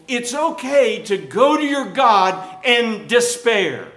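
high, loud, drawn-out sermon cry; full-force preaching. Real, not acted. Pastor telling the congregation despair is allowed, full-force preaching. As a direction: preach it slowly at full volume, pitched high.

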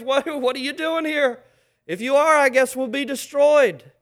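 mock-frightened demon shouting; devil's voice. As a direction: voice the cornered devil, loud and high. Devil quoted in sermon.

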